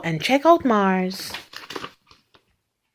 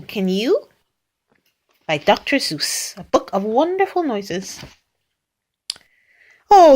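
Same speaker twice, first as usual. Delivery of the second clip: plain reading voice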